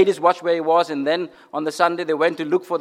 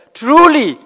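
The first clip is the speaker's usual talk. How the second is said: higher and louder, struck with awe; awed exclamation; 9 semitones higher, 8 dB louder than usual. Acted. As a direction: burst out in awe. Centurion's awed confession.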